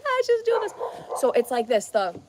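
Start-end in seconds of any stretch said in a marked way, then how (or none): 0.0-1.2 much higher, giddy mock squeal; squealing delight. Vlogger acting out her stop-sign bit, high mock squeal. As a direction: squeal it out as a joke.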